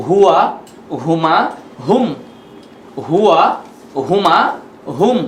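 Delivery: "হুয়া, হুমা, হুম" recited word by word, long gaps between; chant-free model of "হুয়া", "হুমা", "হুম", each isolated for repeating; pronunciation drill. Shown as a demonstration. Teacher leads learners pronouncing pronouns, measured drill with pauses. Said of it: "এখানে আমরা উচ্চারণ করি".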